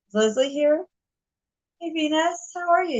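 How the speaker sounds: excited greeting. Real, not acted. Greeting viewers arriving in chat.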